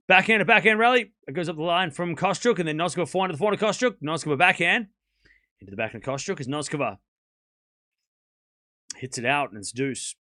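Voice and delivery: fast, rising live tennis call; play-by-play. Acted. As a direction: call the rally as it happens.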